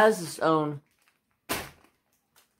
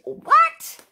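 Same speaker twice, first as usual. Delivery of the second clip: 1 surprised what; 8 dB louder than usual. Retold or imitated. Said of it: "I was like".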